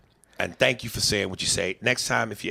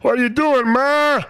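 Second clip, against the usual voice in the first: high, loud whiny Ray Romano call; 14 semitones higher, 7 dB louder than usual. Retold or imitated. Comedian doing Ray Romano calling his mother, high and loud. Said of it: "do the Ray Romano voice".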